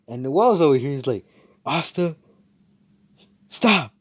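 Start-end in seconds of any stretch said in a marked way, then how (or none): 1.6-3.9 put-on high scolding girl. Noelle scolding Asta.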